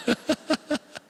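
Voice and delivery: a short high rising giggle. Real, not acted. Laughs about the soup.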